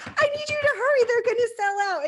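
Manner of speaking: high, loud panicked demand; panicked urging. Retold or imitated. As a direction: shout at the clerk to hurry, high. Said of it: "And I'm like".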